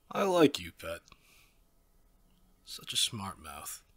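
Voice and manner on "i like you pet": amused. Acted. Villain amused by defiance.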